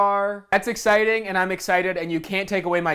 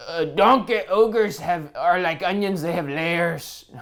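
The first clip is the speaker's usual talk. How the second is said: slow put-on ogre voice; a slow, hammed-up Shrek impression; slower than usual. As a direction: drag it out like a lumbering ogre. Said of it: "We have to do a Shrek voice".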